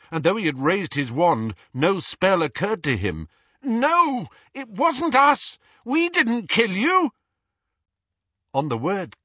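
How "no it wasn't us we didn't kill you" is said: high, loud, drawn-out protest; desperate shout. A narrator's reading. Harry to the dreadful dusty figure, desperate shout. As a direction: shout it high, pleading innocence. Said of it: "Harry shouted".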